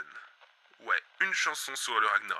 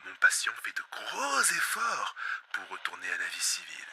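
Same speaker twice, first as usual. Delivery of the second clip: usual speaking voice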